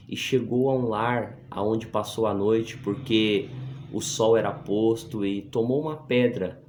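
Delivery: measured, projected Bible reading; scripture reading. Acted. Preacher reads Jacob's vision to the brethren, projected scripture reading. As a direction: read the verse out to the congregation.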